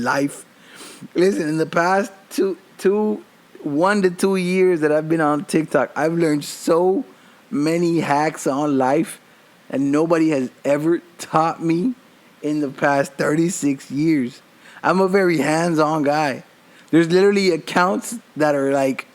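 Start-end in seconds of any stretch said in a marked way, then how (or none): none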